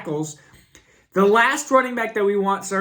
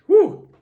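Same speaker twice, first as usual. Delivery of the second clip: loud hacking cough, then a sigh; coughing fit; 12 semitones higher, 7 dB louder than usual. Real, not acted. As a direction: cough hard and blow out. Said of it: "I got a cough".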